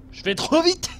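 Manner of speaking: high excited burst. Real, not acted. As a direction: blurt it out, thrilled. Excited exclamation in game.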